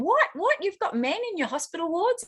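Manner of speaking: high, shocked disbelief; mock shock. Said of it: "were, like, freaking out".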